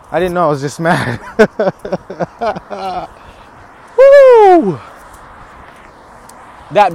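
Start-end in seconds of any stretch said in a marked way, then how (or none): 1.2-3.1 long run of chuckles; soft, rolling laughter; self-amused laugh. Real, not acted. Laughs at being this mad. 4.0-4.8 loud, high "woo"; high, drawn-out holler; hyped whoop. Real, not acted. Psyching himself up.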